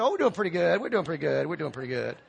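fading smugly to a murmur; smug bluff. Retold or imitated. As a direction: fisherman fibbing about his catch, trailing off. Fisherman bluffing about catch.

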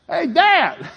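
put-on high, loud kid voice, laughing; little boy. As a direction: call to dad as a small boy. Child voice calling.

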